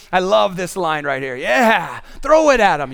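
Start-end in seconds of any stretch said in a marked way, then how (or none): 1.4-2.9 loud, rowdy schoolboy cheer; gleeful middle schooler. Acted. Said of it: "the middle schooler in me".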